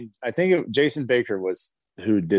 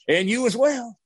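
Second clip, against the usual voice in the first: higher, mock-cheerful send-off; jolly farewell; 11 semitones higher than usual. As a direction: lightly play the jolly man saying goodbye. Coleman's cut-off farewell.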